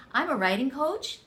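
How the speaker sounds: "I'm a writing coach." ending on a rising question tune; uptalk. Shown as a demonstration. Writing coach demoing uptalk, rising question tune. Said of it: "going up at the end of your sentence like it was a question".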